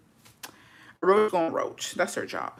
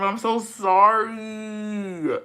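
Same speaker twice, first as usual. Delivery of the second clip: mock apology; 4 semitones higher, much slower than usual. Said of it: "and I'm".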